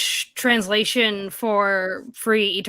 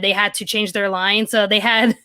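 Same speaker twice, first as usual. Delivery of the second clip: normal talking voice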